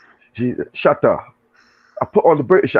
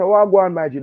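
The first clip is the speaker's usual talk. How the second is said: casual greeting; 7 semitones higher than usual. Retold or imitated. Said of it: "Just a".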